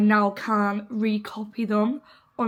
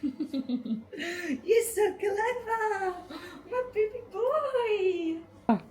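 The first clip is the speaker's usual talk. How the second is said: dog voice with a laugh; 8 semitones higher, 4 dB louder, much slower than usual. Real, not acted. Owner gushing at her puppy in dog voice. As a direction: melt over the puppy, half laughing half crying. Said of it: "talking a dog voice all week".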